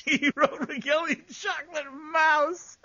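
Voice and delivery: high, loud, slow words through laughter; hysterical laughter. Real, not acted. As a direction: squeal it out mid-laughing fit. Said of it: "I don't know why I'm laughing".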